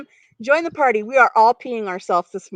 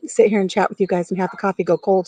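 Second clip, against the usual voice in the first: ordinary talk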